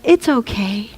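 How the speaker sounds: put-on soft, comforting hush to an upset child; calm someone being cuddled; soothing. Big arms comforting a baby.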